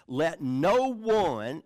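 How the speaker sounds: "no one" slow, high and loud; emphatic. Shown as a demonstration. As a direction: lean hard and slowly on "no one". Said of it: "and that's emphatic".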